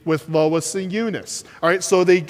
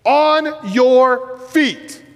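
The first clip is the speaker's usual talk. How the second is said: high, loud, slow shout; booming command to the crippled man; 8 semitones higher, 4 dB louder, much slower than usual. Acted. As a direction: call it out across the courtyard. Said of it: "So he says in a loud voice".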